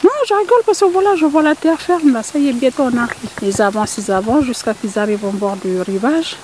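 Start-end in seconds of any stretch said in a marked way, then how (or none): 0.0-3.3 rat rapide, voix bien plus haute; le rat se justifie en vitesse, haut; le rat rusé. Acted. Le rat répond au poulpe. (le rat répond au poulpe, vite et haut)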